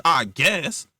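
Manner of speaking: usual speaking voice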